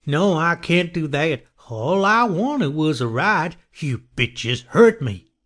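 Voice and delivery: high, slow, drawn-out madman's voice; deranged killer. A narrator's reading. Killer answers his terrified victims.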